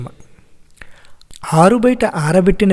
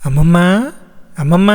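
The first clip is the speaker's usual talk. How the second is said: lightly raised call with rising end; faster than usual. Acted. Grandchild calls grandmother, calling out. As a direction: call out to get grandmother's attention.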